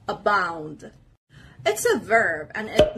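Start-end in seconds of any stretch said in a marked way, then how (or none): 0.1-0.9 careful model of "abound", second syllable stressed; second-part stress. Shown as a demonstration. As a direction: put the weight on the B syllable of "abound". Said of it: "The stress is on the second part".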